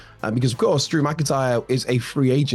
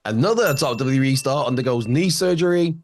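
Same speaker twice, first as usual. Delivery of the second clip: news headline read; 4 semitones higher, slower than usual. Acted.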